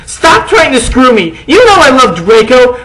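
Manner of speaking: high, loud furious yell; angry shout. Acted. Girl shouting at the vampire, angry. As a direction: yell it and storm off. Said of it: "I shouted".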